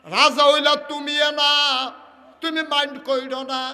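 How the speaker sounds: loud, high chanted plea to God; ringing supplication, easing at the end; king's impassioned prayer. Acted.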